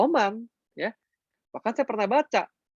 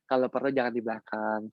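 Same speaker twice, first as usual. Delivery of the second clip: ordinary talk, nothing marked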